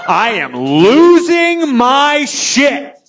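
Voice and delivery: slow, high, loud acted wail; mock losing it, building to a drawn-out bellow; hammed-up drunken bawling. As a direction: stretch every word into an overblown drunken cry. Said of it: "I am crying my eyes out".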